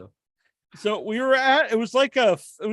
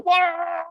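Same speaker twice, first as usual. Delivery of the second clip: mock wail; 11 semitones higher than usual. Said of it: "you were so".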